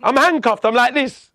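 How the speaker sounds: handcuffed man shouting at running officers, high and loud; exasperated protest. Retold or imitated. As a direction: protest in disbelief while cuffed. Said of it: "I'm saying".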